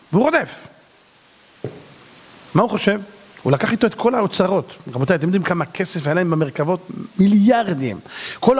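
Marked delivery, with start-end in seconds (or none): none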